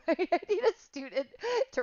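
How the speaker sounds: high, loud burst of laughter running into the words; laughing. Real, not acted. Instructor laughing to her online class about her own slip. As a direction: laugh at yourself as you say it.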